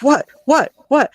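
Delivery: imitating a husband's sudden panic; alarmed husband. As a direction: play the startled husband asking what's wrong. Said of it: "He's like".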